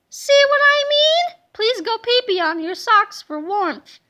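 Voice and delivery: high, loud put-on teacher; mock teacher voice. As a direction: bark it like a silly strict teacher. Teacher voice in comic panel.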